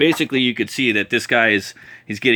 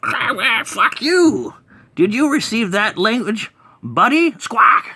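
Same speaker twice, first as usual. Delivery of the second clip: high squawky cartoon bird, louder; bird alien voice; 12 semitones higher, 4 dB louder, slower than usual. Bird alien taunting the mini boss, high squawky voice. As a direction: screech it out like an angry bird creature. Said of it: "He's like".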